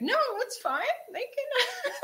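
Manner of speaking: squeaky laughing reply. Retold or imitated. Answering the coach about being singled out, high laughing voice. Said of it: "And I was like".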